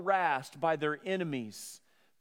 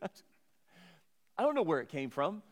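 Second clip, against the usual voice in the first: normal talking voice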